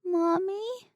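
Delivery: put-on tiny, high little-boy whimper; a frightened child asking for mother; whimpering toddler. A narrator's reading. The dazed little boy calling for his mother, whimpering.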